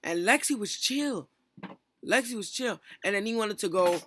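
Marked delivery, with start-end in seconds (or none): none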